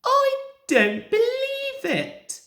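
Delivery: disbelieving. A narrator's reading. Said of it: "said Sir Handel".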